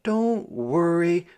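lightly voiced girl, pitched high; reassuring child. A narrator's reading. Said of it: "Clara assured her".

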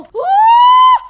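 long high, loud "uh"; high-pitched gamer whoop; excited whoop. Real, not acted. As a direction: whoop at the top of your voice. Cheering mid-game.

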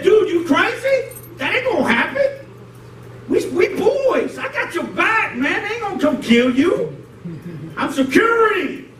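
high-pitched, worked-up homeboy protest; Peter. Acted. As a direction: argue like a loyal bodyguard buddy, voice high. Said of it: "that's how Peter was acting".

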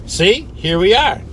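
triumphant. Real, not acted. Triumphant after getting lost.

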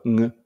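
ん voiced as a nasal ng; ng sound. Shown as a demonstration. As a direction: hold ん as a Korean final ng. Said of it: "母音の後では、んと して発音します". (ん を鼻にかけた「ング」の音で)

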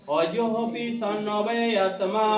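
slow, measured recitation of সম্ভাবামি আত্মমায়া; a teacher slowly chants the Sanskrit verse line by line. Shown as a demonstration. (শ্লোক আবৃত্তির সুরে ধীরে পাঠ)

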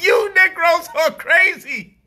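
high, slow, loud disbelief; incredulous shout. Retold or imitated. Reacting to protesters, slow loud mocking disbelief. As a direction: shout it slowly, laughing at them. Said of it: "I'm like".

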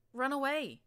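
high, loud cry to flee; panicked shout. Acted. Narrator yells at Glory to flee the scorpion, loud and high. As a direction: yell it as you bolt.

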